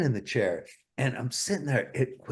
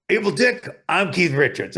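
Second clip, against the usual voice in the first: [name]; 7 semitones higher, 7 dB louder than usual. Retold or imitated. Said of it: "and he goes".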